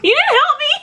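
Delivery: laughing outcry. Real, not acted. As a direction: yell it through laughter at the screen. Laughing complaint to the video.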